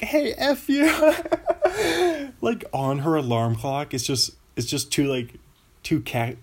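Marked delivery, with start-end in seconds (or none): 0.0-0.9 high mock yell at another driver; yelling cab driver. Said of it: "two guys are like". 0.9-2.3 high burst of laughter. Real, not acted. Laughs at own bit.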